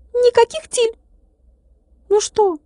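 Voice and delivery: high thin voice, still offended; a little glass man refusing to make up; sulky tiny glass man. A narrator's reading. Tilka sulking. (обиженный стеклянный человечек, тонко и высоко)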